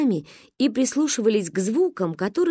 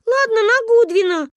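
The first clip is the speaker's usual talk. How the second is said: cheeky insistence; 7 dB louder than usual. Acted. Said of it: "не сдавалась моя подруга".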